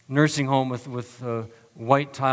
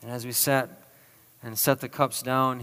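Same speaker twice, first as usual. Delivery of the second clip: ordinary delivery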